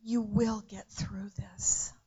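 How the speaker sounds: hushed, slow whisper to a grieving sister. Retold or imitated. Preacher whispering comfort to his grieving sister.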